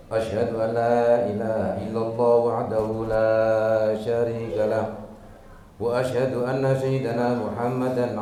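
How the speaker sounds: formal Arabic recitation on a low, level tone; intoned sermon opening. Real, not acted. Preacher greeting the congregation and praising God, intoned formally.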